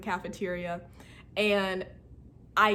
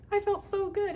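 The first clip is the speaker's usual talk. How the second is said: much higher, quick, giddy repeat; high giddy delight; 12 semitones higher than usual. Real, not acted. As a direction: squeal the words at normal speed. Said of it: "I was so excited".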